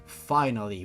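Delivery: ordinary delivery, nothing marked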